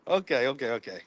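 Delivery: ordinary talk, nothing marked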